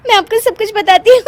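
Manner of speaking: high, fast, giggling बताती हूँ; laughing and quick; giggly quick promise. Acted. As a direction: rush the words through laughter. Wife explains, laughing.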